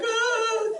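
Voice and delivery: loud high mock scream of the tram crowd; everybody screaming. Retelling how the tram riders screamed, mock shriek. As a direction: shriek like the whole tram panicking. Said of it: "and everybody's like".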